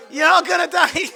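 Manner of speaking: loud, high-pitched joking shout; mock doomsday yell. Speaker leading the conference in unison, playful yell. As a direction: call it out for the room to echo. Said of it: "You do it first and I'll do it".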